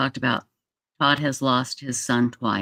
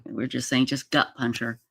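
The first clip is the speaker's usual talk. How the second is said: usual speaking voice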